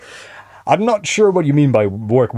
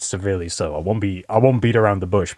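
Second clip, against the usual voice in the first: ordinary delivery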